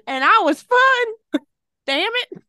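put-on high, slow crotchety old-timer; old person voice. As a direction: grumble like a nostalgic grandparent. Continues the old-timer bit.

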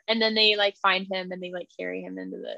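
ordinary talk, nothing marked

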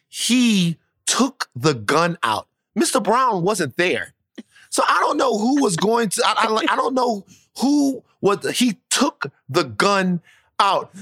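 slow, high, drawn-out disbelief; incredulous telling. Real, not acted. Son tells of dad drawing a gun, slow and astonished. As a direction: stretch the words out, rising in astonishment. Storyteller stunned by dad's gun.

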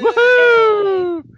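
high, loud cheering whoop; excited whoop. Real, not acted. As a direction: whoop like a crowd welcoming her. Host cheers guest joining.

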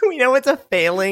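high voice breaking up with laughter; real giggles through the words; laughing. Real, not acted. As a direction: try to get it out while laughing. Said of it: "please do it without laughing".

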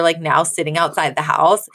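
usual speaking voice

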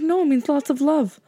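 high, chirpy mock-mom correction; mom's cheerful correction. Mocking mom explains the text to her daughter, high and chirpy. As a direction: play the clueless mom, bright and sure. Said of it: "She's like".

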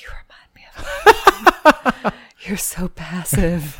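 imitating his hushed date-night whisper; mimicked whisper. Co-host replaying the first cuddling date, in a mock whisper. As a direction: whisper it as a mock sweet nothing. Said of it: "like, when he's, like, whispering".

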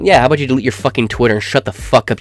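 usual speaking voice

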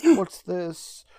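startled, high and loud; surprised gasp. Retold or imitated. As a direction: caught off guard mid-transformation.

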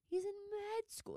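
high mocking disbelief; high exclaim. Shown as a demonstration. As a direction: squeal it in disbelief.

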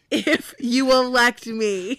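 loud, slow, high stump-speech finish; mock campaign speech. Candidate asking voters to elect him, hammed-up oratory. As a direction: draw the words out for the crowd.

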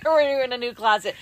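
loud, laughing through the words. Real, not acted. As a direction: still giggling as you say it. Repeats line after laughing.

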